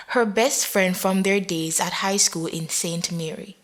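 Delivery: plain reading voice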